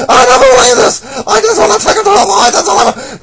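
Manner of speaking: high, loud, laughing disbelief; exasperated high squeal. Acted. Customer squealing in disbelief at the clerk. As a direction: squeal it out in outrage.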